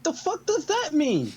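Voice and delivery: high, loud disbelief; baffled outburst. Acted. Character baffled by talk of dimensions, loud high outburst.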